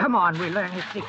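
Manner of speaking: high, fast and loud rush of words; excited shout while scrambling over the raft; frantic urging. Acted. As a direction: drive the others on, breathless with greed.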